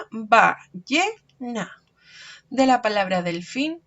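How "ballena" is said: ballena said very slowly, ba-lle-na; slow syllables. Shown as a demonstration. Teacher counting syllables for children, very slow. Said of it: "De la palabra ballena".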